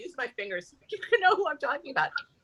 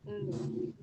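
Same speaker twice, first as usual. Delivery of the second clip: ordinary talk, nothing marked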